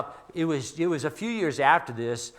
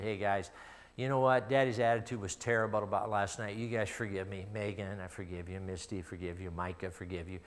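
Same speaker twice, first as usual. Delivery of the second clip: soft apology; 7 semitones lower, 9 dB quieter than usual. Retold or imitated. Father apologizing to his kids.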